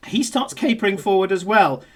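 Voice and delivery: normal talking voice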